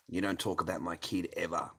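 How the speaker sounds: ordinary delivery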